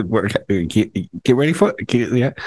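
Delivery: ordinary talk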